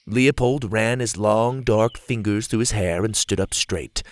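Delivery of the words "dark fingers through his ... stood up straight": ordinary delivery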